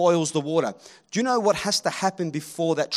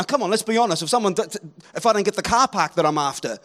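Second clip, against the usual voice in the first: ordinary talk, nothing marked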